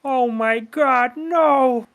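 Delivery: high, drawn-out mock horror; cartoonish cry of dread; cutaway gag voice. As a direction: wail it slowly, high and hammed up. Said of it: "it's a fucking Family Guy cutaway".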